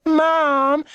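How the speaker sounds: high drawn-out whine of Mom; a little girl appealing to her mother; high childish whine; whining little girl. A narrator's reading. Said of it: "the smaller girl whined".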